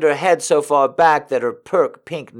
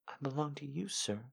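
much softer, barely breathed; submissive hush; 2 semitones higher, 22 dB quieter, faster than usual. A narrator's reading. Bound woman answers her master, hushed and submissive. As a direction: answer him meekly under your breath.

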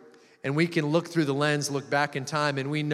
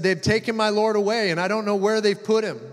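higher, louder lament; acted distress, pitched up and insistent; distressed plea; 5 semitones higher, 4 dB louder than usual. A narrator's reading. Crying Mary answers the angels at the tomb, distressed. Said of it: "and she was crying".

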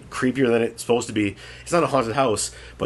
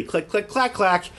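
light clicking clack sounds, higher; typewriter noises; 6 semitones higher, slower than usual. Retold or imitated. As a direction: tap out typewriter clicks with the mouth. Imitating typewriter keys.

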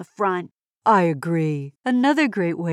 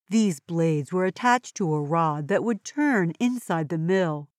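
ordinary talk, nothing marked

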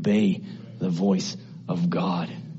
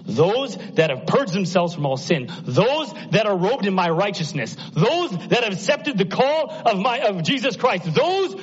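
rising higher with each repeated phrase; impassioned, climbing preacher's cadence; building preaching; 9 semitones higher than usual. Real, not acted. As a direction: build the pulpit list to a high peak.

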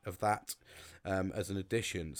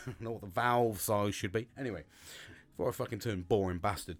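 ordinary talk, nothing marked

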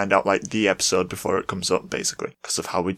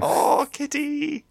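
cooing at cat; 4 dB louder, much slower than usual. Real, not acted. Greeting the cat.

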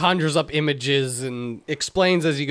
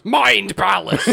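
drawn-out, slow, higher proclamation; hammed-up incantation; 4 semitones higher, 3 dB louder, slower than usual. Wizard grabbing visitor's head, grandly proclaiming. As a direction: stretch both words like a grand spell.

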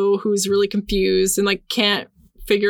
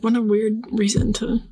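whisper. Retold or imitated. Owen musing over sweater reason.

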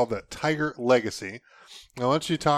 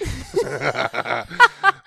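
stifled giggles; 2 semitones higher, 5 dB louder than usual. Real, not acted. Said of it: "she's trying not to laugh over here".

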